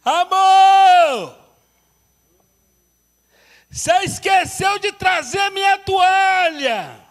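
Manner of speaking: high, loud calling shout; shouting from the bathroom. Retold or imitated. Wife yelling from the shower that he forgot her towel, loud shout. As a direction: yell it across the house, slowed and stretched out. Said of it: "alguém grita do banheiro".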